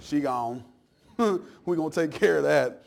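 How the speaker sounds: lightly voiced God mocking Tyre. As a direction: taunt the city with a grin. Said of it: "God's like".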